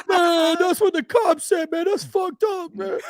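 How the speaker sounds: high, slow whining complaint through laughter; whiny upset man. Retold or imitated. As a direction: whine like a hurt guy, half laughing. Voicing upset injured man.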